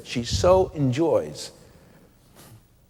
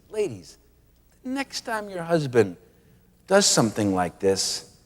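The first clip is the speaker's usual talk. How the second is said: normal talking voice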